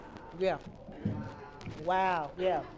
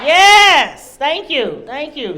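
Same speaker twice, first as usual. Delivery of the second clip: big drawn-out shout, then easing off; exultant thanks; 8 semitones higher, 16 dB louder, much slower than usual. Real, not acted. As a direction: shout your thanks over the cheering. Answering the crowd's cheer.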